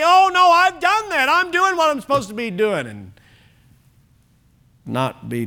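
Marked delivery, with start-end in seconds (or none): 0.0-2.9 high, protesting denial; defensive protest. Retold or imitated. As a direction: whine your excuse higher. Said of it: "we can even lie and say".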